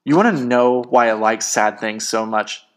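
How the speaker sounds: worked-up, self-justifying outburst; heated rant. Acted. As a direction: pour out a bitter argument. Breathing heavy, ranting in quarrel.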